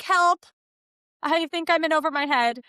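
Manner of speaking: high, loud cry of help that tapers off; a parent out of their depth, calling up; pleading for help. Pretend.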